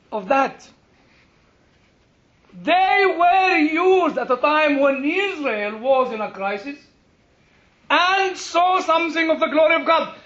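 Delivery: fervent pulpit proclamation; impassioned preaching. Real, not acted. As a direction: drive the point home to the congregation. Preaches at full force.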